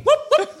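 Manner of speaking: high, loud clucking whoops, laughing. Shown as a demonstration. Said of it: "Oh, look, it's clucking".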